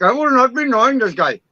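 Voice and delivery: high imitation of an Indian accent; mock Indian accent. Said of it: "I do a really good, uh, uh, Indian one too".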